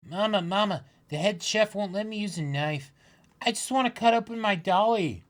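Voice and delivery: put-on high, pleading kid voice; a little girl begging her mother; child voice. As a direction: whine like a small child asking mama. Voicing child in manga.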